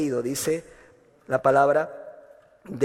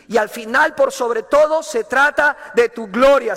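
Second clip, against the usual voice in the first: louder, higher, slowing on "tu gloria"; fervent climax; 4 semitones higher, 6 dB louder than usual. Retold or imitated. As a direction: swell up to the glory of God. Prayer climax.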